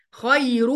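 "خير" said khayrun with a clear final n; tanween as nun. Shown as a demonstration. Teacher showing the hidden nun, "خير" ending in a clear n. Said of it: "كأنها هتتنطق خير".

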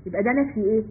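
ordinary talk

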